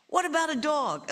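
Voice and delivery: put-on high, loud child's question. Child surprises parents.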